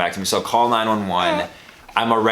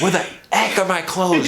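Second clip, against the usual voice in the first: high-pitched mock panic; comic exasperated outburst; frantic retelling; 8 semitones higher than usual. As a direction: act out a frantic search, voice jumping up. Retelling his frantic clothes search.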